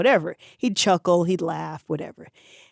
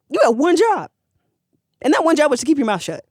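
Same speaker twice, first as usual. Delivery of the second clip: higher and louder, blowing up; angry shout; 7 semitones higher, 3 dB louder than usual. Retold or imitated. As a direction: yell it at him, furious. Big Jim explodes at the blabber.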